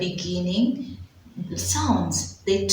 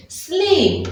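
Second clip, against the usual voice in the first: sudden bright answer; 10 semitones higher, 4 dB louder than usual. Shown as a demonstration. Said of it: "What am I saying?".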